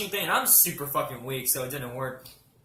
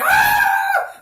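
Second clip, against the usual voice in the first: sudden high piercing scream, much louder; 12 dB louder than usual. Retold or imitated. Dragged by the dog, retelling her shocked scream. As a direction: let out a loud startled yell. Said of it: "And I was like".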